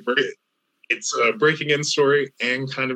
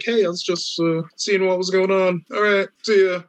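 high, odd-sounding voice of a creepy drop-in visitor; high, offbeat mock of a creepy visitor; awkward stalker guy; 8 semitones higher than usual. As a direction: play the weird guy with a casual excuse.

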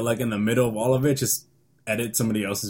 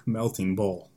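dull monotone; 4 semitones lower, 5 dB quieter, slower than usual. Shown as a demonstration. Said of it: "you just say it plainly".

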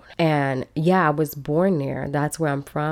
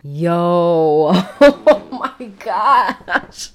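disbelieving laughter; 6 dB louder, much slower than usual. Real, not acted. Vlogger recalling the fee advice, laughing in disbelief. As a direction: burst out laughing through the words.

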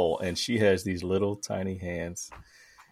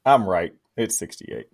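ordinary delivery

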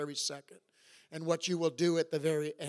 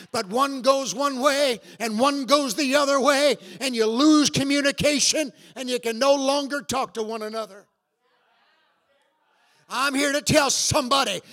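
loud, high fervent preaching; 6 semitones higher, 11 dB louder than usual. Real, not acted. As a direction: preach it with rising fire. Preaching at full force.

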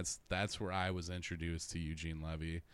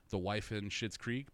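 ordinary talk

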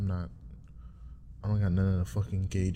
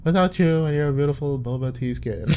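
mock romantic; 4 semitones higher, 5 dB louder than usual. Mock lover continues.

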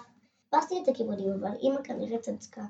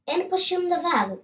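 put-on higher, louder disappointed child voice; little girl complaining; 7 semitones higher, 4 dB louder than usual. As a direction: sound like a let-down little girl. (ילדה קטנה מאוכזבת, קול גבוה)

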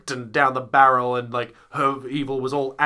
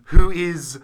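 ordinary delivery

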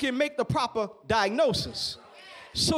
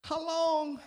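calm; 4 semitones lower, 10 dB quieter than usual. Acted. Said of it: "calmly, calmly now".

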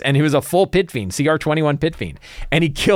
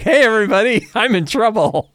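hammed-up call for help to the whole room; mock cry for help; 7 semitones higher than usual. Player voicing a character calling to everyone, hammed-up plea. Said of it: "And she's like".

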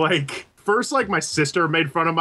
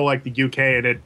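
usual speaking voice

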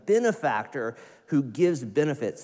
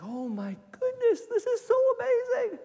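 very high, gushing wonder; awestruck delight. Retold or imitated. Grateful person overwhelmed by wonder, high squeal. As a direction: squeal it like everything is new. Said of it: "It's just like".

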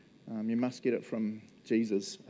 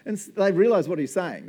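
ordinary talk

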